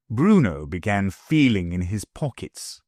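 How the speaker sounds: ordinary delivery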